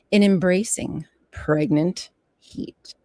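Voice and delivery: normal talking voice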